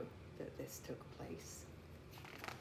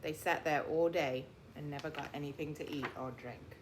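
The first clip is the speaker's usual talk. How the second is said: ordinary delivery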